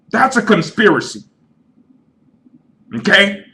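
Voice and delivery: high, loud and wild-eyed, shouting over everyone; a paranoid buddy yelling that it's terrorists; hammed-up hysterical conspiracy rant; frantic crazy friend. Crazy friend yells back.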